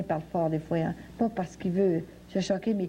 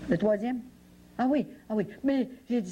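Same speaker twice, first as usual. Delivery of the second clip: ordinary talk, nothing marked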